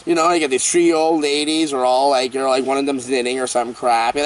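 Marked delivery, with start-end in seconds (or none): none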